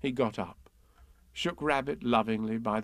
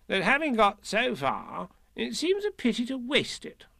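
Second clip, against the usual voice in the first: high-pitched fussy rabbit; brisk Rabbit; 11 semitones higher than usual. Acted. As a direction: pipe up as a busy rabbit. Voices Rabbit.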